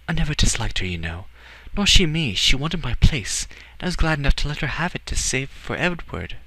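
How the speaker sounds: high-pitched woman's character voice; Alira, gently earnest. Acted.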